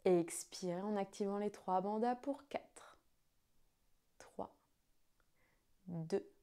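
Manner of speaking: slow, hushed guided breathing count. Acted.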